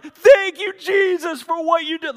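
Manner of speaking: high, loud cry of thanks; retold worship yell, very loud and high; screaming praise. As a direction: shout it to the rafters. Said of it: "They start screaming and praising".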